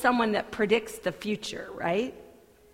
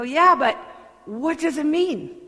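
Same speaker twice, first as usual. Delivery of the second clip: questioning crowd; 6 semitones higher, 8 dB louder than usual. Acted.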